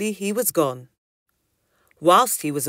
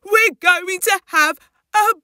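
high, loud, overjoyed exclamations; excited cry; 12 semitones higher, 7 dB louder than usual. Acted. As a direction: cry out in amazed delight. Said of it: "he cried".

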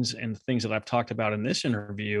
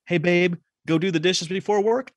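higher, direct ask to a partner; direct request; 6 semitones higher than usual. Retold or imitated. Wife asking husband directly, plain higher request. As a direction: ask it straight out. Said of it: "if you say".

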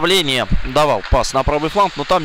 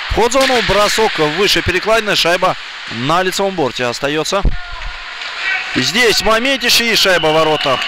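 excited hockey goal call; 4 semitones higher than usual. Real, not acted. Goal scored live.

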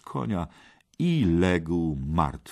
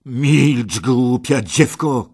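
loud, raised, drawn-out fury; angry shout; 6 semitones higher, 10 dB louder, much slower than usual. Acted. Kej rebukes the maiden, shouting angrily. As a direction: silence the girl with a harsh command. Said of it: "krzyknął gniewnie Kej".

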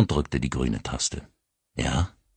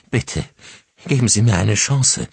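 higher, louder tearful plea; pleading; 5 semitones higher, 6 dB louder than usual. Acted. Carly begging the grieving father, near tears. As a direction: beg him for a chance. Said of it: "flehte Carly".

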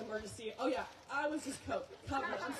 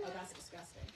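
soft, breathy, grossed-out murmur; a quiet, near-voiceless "ew"; disgusted; 6 semitones lower, 9 dB quieter than usual. Retold or imitated. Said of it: "I'm like".

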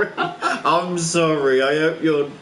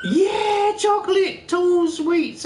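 excited; 13 semitones higher, 3 dB louder, slower than usual. Real, not acted. Laughing excitement over sweets.

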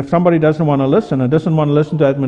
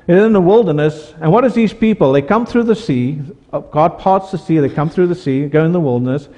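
ordinary talk, nothing marked

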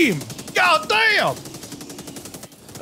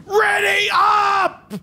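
slow, drawn-out barked command; 4 semitones higher, much slower than usual. Acted. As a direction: bark it at the squad. Sergeant command, slow shout.

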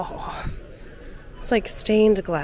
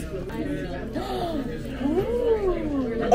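excited gasp; 6 semitones higher, 5 dB quieter than usual. Real, not acted. Opening a surprise blind box pin.

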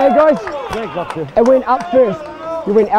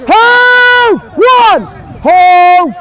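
shouted calls; 5 semitones higher, 5 dB louder, much slower than usual. Real, not acted. Referee calling tackle counts.

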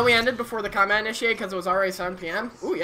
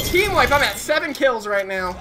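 excited shout; 4 semitones higher, 6 dB louder than usual. Real, not acted. Scores a team wipe in game.

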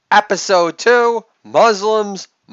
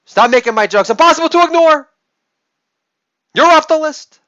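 high, loud comic scolding; mock outraged shout; 11 semitones higher, 6 dB louder, faster than usual. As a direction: yell it in mock outrage at being ignored. Mock scolding of ignored friend.

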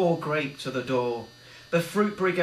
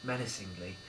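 usual speaking voice

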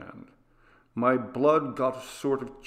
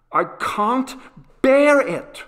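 breaking out high and much louder in anguish; anguished cry; 13 semitones higher, 9 dB louder, slower than usual. A narrator's reading. Boy bursting into a louder, higher anguished cry to his father. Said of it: "cried my generous son".

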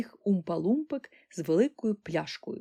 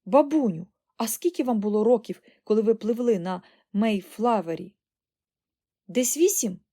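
put-on eager boy; 3 semitones higher, 7 dB louder than usual. A narrator's reading. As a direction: press granny excitedly for answers. Boy eagerly questions granny.

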